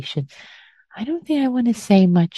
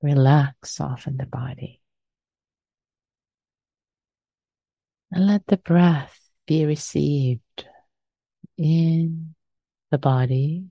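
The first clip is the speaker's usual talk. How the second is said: guided meditation. Acted. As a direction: ease them gently into stillness.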